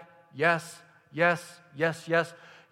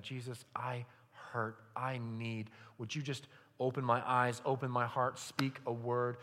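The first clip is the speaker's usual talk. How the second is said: hushed prayer; 4 semitones lower, 9 dB quieter than usual. Pretend.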